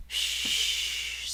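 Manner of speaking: whispered hush; calm him with a soft shh; shushing. Acted. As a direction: sister hushing her crying brother, whispered. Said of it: "said Probity".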